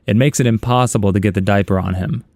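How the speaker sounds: ordinary delivery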